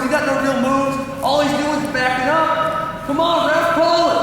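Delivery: high-pitched, indignant sideline complaining; yelling at the ref. Acted. Parent berating ref and rival coach over stalling, yelling indignantly. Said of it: "they're yelling at the ref".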